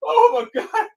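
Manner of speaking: can barely talk through laughter; laughing hard. Real, not acted. Laughing himself to tears over the dog story. Helpless laughter after joke.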